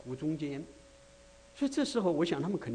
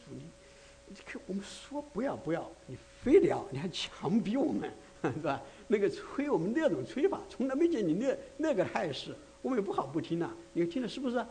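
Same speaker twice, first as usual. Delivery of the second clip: a hint of disciples' grievance; complaining to the Lord; 5 semitones higher than usual. (门徒向主抱怨，偏高的不满语气)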